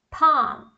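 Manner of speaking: "palm" as PAHM, clearly isolated; silent L. Shown as a demonstration. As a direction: say "palm" with no L at all. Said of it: "o L não é pronunciado". ("palm" sem L, PAAM)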